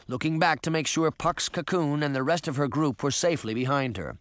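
usual speaking voice

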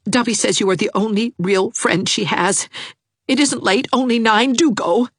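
put-on earnest young suitor pleading; Jarvis pleading. A narrator's reading. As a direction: beg her to go for you.

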